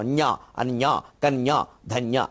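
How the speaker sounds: అన్య, కన్య, ధన్య each with a crisp clear nya; careful nya sounds. Shown as a demonstration. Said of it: "స్పష్టంగా పలకవలను".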